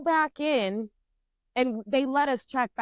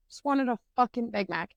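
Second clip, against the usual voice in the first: normal talking voice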